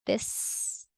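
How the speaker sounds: ordinary talk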